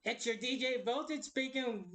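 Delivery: normal talking voice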